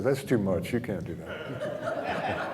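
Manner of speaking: a hint of a scolding ego, slow and half-laughing; inner ego voice. Speaker voicing his ego's objection, lightly mock scolding. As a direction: play your own nagging ego, amused. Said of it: "my ego said".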